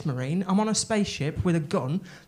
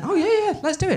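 Tennant's eager yes; 4 dB louder than usual. Retold or imitated. Voicing David Tennant agreeing.

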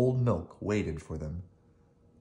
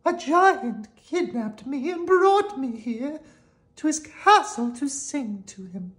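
high, slow, delicate voice; gentle Golden Harp; 6 dB louder, slower than usual. Acted. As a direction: tell of the giant in a high fairy voice. Harp explains.

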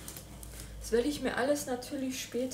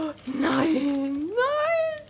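much higher, loud, near tears; overjoyed surprise; 14 semitones higher, 5 dB louder, much slower than usual. Real, not acted. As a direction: squeal in disbelief. Opens the gift, overwhelmed.

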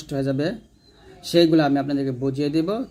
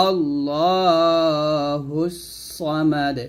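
slow chanted recitation; Quran recitation; much slower than usual. Shown as a demonstration. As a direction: draw out the verse in tajweed. (ধীর সুরে তিলাওয়াত)

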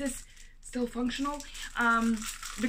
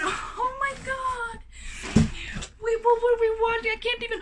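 excited squeal; 9 semitones higher, 5 dB louder than usual. Real, not acted. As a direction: squeal it out long. Remembering the horror movie.

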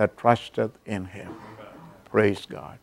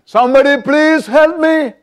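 high, loud, desperate cry for help; 13 semitones higher, 4 dB louder than usual. Retold or imitated. Hanging from a cliff, a man screams for help. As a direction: call out to anyone above, scared. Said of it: "and he cried out".

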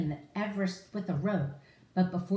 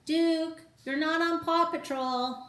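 higher, gently telling off the dog; a mild scolding to a pet; lightly voiced Ryder; 8 semitones higher than usual. Ryder telling Duke he's not on the team, mild scold.

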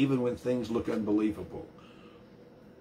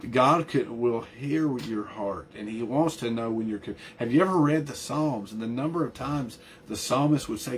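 ordinary talk, nothing marked

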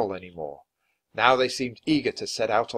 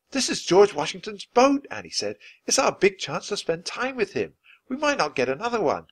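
put-on eager girl; 10 semitones higher than usual. A narrator's reading. Annie eager about the boat.

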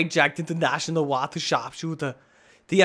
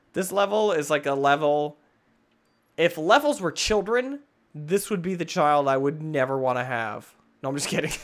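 ordinary delivery

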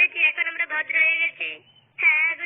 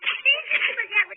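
high, slow, sobbing shout of innocence; wailing that they swear they know nothing; crying plea; 5 semitones higher, much slower than usual. Acted. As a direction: beg through sobs, voice cracking high. Accused begging while being beaten.

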